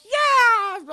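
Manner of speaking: high, very loud excited whoop; big cheer. Retold or imitated. Koenma cheering for Yusuke, very loud high whoop. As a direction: cheer like your team just won.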